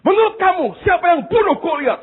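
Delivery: high, loud angry question; angry pastor. Retold or imitated. Pastor angrily questioning the next student, high and loud. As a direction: demand the answer angrily. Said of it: "Sambil marah".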